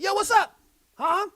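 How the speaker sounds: high, loud Brooklyn holler; put-on Brooklyn street greeting; Brooklyn accent. Shown as a demonstration. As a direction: call out big and brash, Brooklyn style. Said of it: "I talk like Brooklyn".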